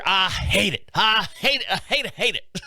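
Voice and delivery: imitated griping, swooping on each "hate"; put-on, exaggerated disgust; mock loathing. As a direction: repeat it like a hammed-up complaint. Same woman five years later, complaining.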